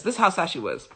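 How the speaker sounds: a hushed, sorrowful echo; sad. Shown as a demonstration. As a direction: let it sink, grieving.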